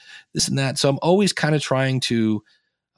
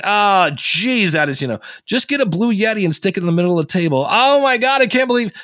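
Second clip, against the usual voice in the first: groaning exasperation; 8 semitones higher, 4 dB louder than usual. Retold or imitated. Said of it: "and I'm like".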